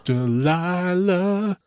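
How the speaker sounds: dreamy drawn-out name. Acted. Swooning over radio host name.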